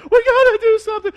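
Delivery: high, loud, urgent mock panic; hammed-up panicked wife; hammed-up frantic wife pleading for help. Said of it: "Stacy's like".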